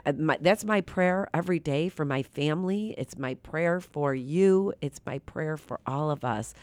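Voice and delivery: ordinary talk, nothing marked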